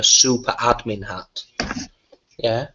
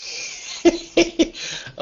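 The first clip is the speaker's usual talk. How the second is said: high giggle; 3 dB quieter than usual. Real, not acted. High unvoiced laugh.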